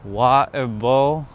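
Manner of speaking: slow British water bowl, no T; water bowl with the T dropped, British style. Shown as a demonstration. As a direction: drop the T, slow and careful. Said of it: "I say".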